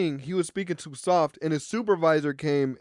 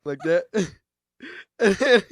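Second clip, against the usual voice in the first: normal talking voice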